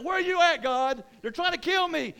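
high, loud cry for help; frantic plea. Acted. David down, calling on God for rescue, frantic shout. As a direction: shout up to God in acted panic. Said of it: "He's like".

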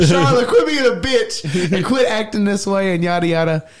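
high, laughing telling-off; mock scolding through laughter. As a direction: scold her while cracking up. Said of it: "I was like".

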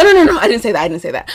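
ordinary talk, nothing marked

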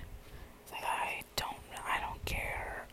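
slow, barely voiced whisper; hushed whispered aside; retold whisper. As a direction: whisper it under your breath, drawn out.

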